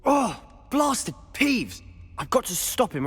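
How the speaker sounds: frustrated outburst; exasperated shout. Acted. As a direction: curse him out in frustration. Exasperated at the poltergeist.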